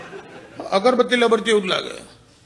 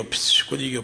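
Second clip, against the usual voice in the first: unvoiced whisper of the misfire, softer; whispered fizzle; 4 semitones lower, 5 dB quieter than usual. Teller whispering the rifle's misfire to the crowd. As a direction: breathe the words out as the gun fails.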